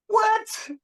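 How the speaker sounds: very high squeaked what; high-pitched incredulous yelp; astonished reaction. Retold or imitated. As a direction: reenact jaw-dropped disbelief in falsetto. His stunned reaction at concert.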